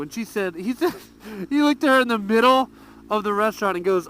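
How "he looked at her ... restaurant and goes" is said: ordinary delivery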